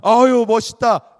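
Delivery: loud, high, slow gushing sigh; woman admiring a hunter, gushing; swooning admiration. Retold or imitated. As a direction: swoon over him.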